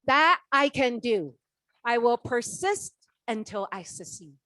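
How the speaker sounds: determined vow. Retold or imitated. She vows to her mentor to persist, loud and firm. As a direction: declare it with firm resolve. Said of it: "I say".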